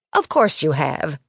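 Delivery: understated hearty agreement; a touch of put-on heartiness. A narrator's reading. Boss Sally agreeing with the librarian, lightly hearty. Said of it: "Sally said in a hearty manner not at all like her".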